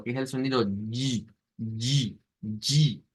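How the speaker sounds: "yi" con una y sonora vibrada, repetida; sonido yi. Shown as a demonstration. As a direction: pronuncia "yi" como en "la gina", y sonora. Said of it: "tiene ese sonido". ("yi" sonoro, repetido)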